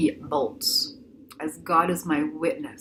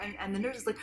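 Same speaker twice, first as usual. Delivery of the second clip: ordinary talk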